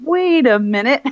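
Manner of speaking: mock dawning surprise, rising at the end; retold answering recognition. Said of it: "And I went".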